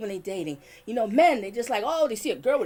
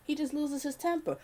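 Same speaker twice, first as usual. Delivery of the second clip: softer, self-convincing excuse-making; mild quiet imitation; 8 dB quieter than usual. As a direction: voice a victim quietly talking herself into it. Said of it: "They actually think".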